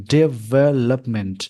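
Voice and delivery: Development slowly broken as de-VE-lup-ment; syllable by syllable. Shown as a demonstration. Teacher showing the breaking points, syllable by syllable. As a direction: stretch development into four slow beats. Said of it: "breaking point है".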